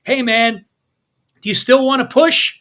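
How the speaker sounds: calling out into the night; shouting. A narrator's reading. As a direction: shout it across the yard. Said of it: "so he shouts".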